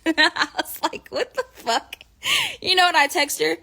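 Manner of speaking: high, laughing "what the fuck"; laughing disbelief. Real, not acted. Laughing at grandma's rude selfie.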